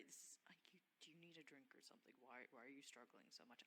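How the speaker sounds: soft unvoiced whisper. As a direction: whisper it close and quiet. Whispered aside to Briar.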